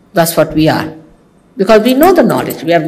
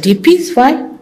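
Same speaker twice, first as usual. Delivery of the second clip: 1 shocked question; 7 semitones higher than usual. Retold or imitated. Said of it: "I said".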